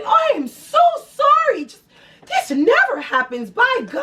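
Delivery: very high, loud, gushing apology; happy Publix clerk. Retold or imitated. At the return counter, a very high, gushing clerk. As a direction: gush like an overjoyed store clerk. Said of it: "They will be happy".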